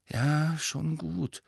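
bedrückt und widerwillig nachgeben; leicht angehobene, schwere Stimme. A narrator's reading. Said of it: "sagte Harry mit schwerer Stimme". (mit schwerer Stimme)

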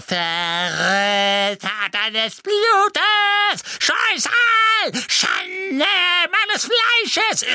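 drawn-out, very high screech; howling abuse. A narrator's reading. Old woman cursing her own kin, howling shriek. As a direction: howl curses like a frenzied hag. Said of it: "heulte sie".

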